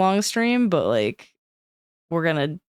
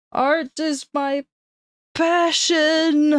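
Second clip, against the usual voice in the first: high, slow, swelling declaration; dramatic high voice; 10 semitones higher, much slower than usual. Pretend. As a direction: proclaim it like an opera diva. Dramatic higher repeat.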